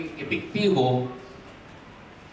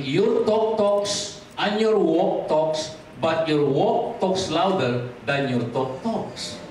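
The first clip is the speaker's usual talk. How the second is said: slow, deliberate tongue twister read; slower than usual. Shown as a demonstration. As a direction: spell it out slowly like a tongue twister. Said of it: "Pakibasa nga ito, parang tongue twister to".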